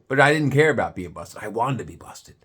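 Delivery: ordinary talk, nothing marked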